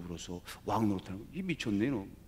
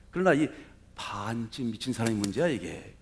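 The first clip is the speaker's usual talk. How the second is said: ordinary talk, nothing marked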